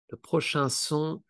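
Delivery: normal talking voice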